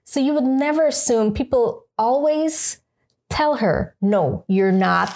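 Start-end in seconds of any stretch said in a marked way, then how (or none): none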